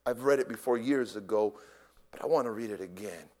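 usual speaking voice

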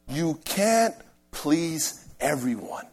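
usual speaking voice

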